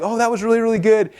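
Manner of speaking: high, mock-polite praise. Audience telling the flustered speaker it was good, said high and mock-polite. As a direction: voice the audience being nice about a flop.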